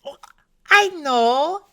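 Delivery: put-on high, loud drawn-out child's cry; Josette. A narrator's reading. Small girl recognizing the name, high loud exclamation. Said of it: "says Josette".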